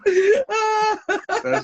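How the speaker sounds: squealing high through laughter; words breaking into laughter; laughing hard. Real, not acted. Cracking up mid-sentence.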